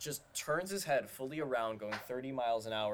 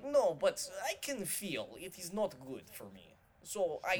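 put-on higher, a light Russian accent; Russian driver impression; 6 semitones higher than usual. Quoting the Russian Uber driver to friends, light Russian accent. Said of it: "And he was like".

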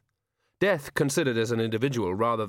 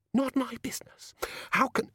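breathless shocked whisper; 12 semitones higher than usual. A narrator's reading. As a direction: whisper it in disbelief. Susan, stunned, under her breath.

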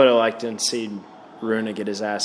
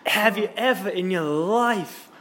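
mock commentator; 6 semitones higher than usual. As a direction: play the stunned broadcaster. Commentator voiced reacting.

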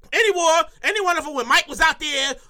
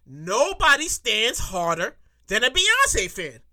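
slow, deliberate delivery with pauses; drawn-out, weighty restatement; emphatic repeat; 6 semitones lower, slower than usual. Shown as a demonstration. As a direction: spell out each word for emphasis. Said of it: "Maybe I'll say that again".

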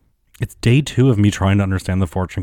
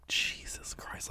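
slow whispered jesus christ; whispered exasperation; 18 dB quieter, slower than usual. Retold or imitated. Whispering in disbelief at the honking Uber driver. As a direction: breathe it out in disbelief.

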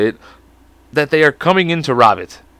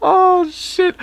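high squeal. Real, not acted. Sudden high exclamation.

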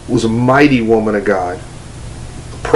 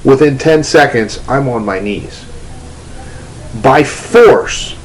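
ordinary talk, nothing marked

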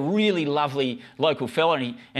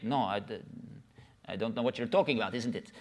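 put-on Indian accent; 5 semitones lower, 7 dB quieter than usual.

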